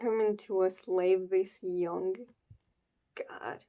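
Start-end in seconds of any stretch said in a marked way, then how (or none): 3.1-3.6 a low, breathed-out whisper of dismay. Real, not acted. Reader reacting to the enslaved child, hushed whisper. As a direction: let it slip out under the breath.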